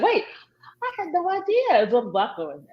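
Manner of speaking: high startled retold exclamation; surprised. Retelling surprised woman on Zoom.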